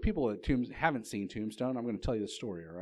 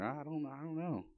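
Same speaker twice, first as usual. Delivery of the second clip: higher, softer acted dithering; mildly worried; 4 semitones higher, 6 dB quieter than usual. Believers lose their peace to Satan, mildly worried. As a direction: dither with a touch of worry. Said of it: "we, we get worried".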